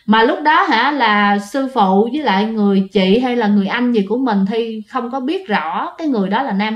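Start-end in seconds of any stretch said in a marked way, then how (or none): none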